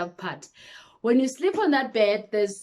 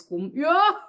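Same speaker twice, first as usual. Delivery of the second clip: shocked yelp; 5 semitones higher, 3 dB louder than usual. Real, not acted. Shocked at doctor's action.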